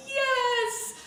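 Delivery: high, drawn-out squeal of delight; excited cheer. Retold or imitated. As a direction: let out a triumphant, high-pitched yes. Child celebrates finding the gift.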